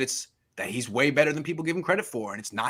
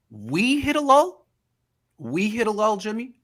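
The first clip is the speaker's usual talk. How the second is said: incredulous mocking echo; 4 semitones higher than usual. Real, not acted. Mocking the quarterback's words.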